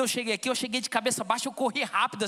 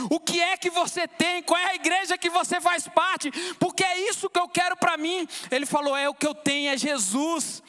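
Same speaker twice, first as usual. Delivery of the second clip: higher-pitched, fervent testimony; impassioned preaching; 4 semitones higher than usual. Real, not acted. Preacher retelling his brother's words to the congregation, impassioned. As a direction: preach it with rising fervour, voice lifted.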